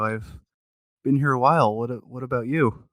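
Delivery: ordinary talk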